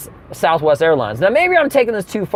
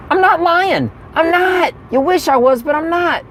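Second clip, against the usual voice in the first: much higher, insistent voice; high-pitched protest; 11 semitones higher than usual. Acted. Comic insisting to the crowd that it's true, high-pitched protest. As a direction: protest high up in your range.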